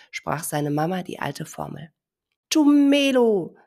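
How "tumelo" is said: high, loud, slow proud call. Acted. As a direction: shout out the new name. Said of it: "rief der kleine Elefant laut".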